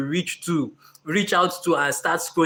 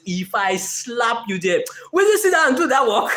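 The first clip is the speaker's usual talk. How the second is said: mock threat; 6 semitones higher than usual. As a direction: playfully threaten the lazy self. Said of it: "Dream [name] says".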